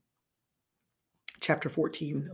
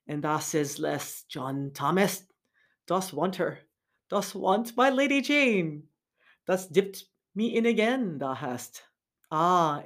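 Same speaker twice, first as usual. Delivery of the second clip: Derbyshire dialect; 4 dB louder than usual. Acted. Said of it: "using his local al-, accent".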